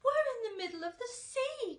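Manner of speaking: high alarmed cry. A narrator's reading. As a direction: cry out in shock as the land vanishes. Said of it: "cried James".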